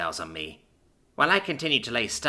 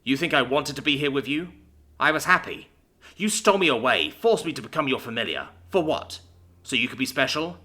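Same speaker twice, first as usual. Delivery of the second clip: bitter accusation; 5 semitones higher, 3 dB louder than usual. A narrator's reading. Bitter friend accuses the narrator.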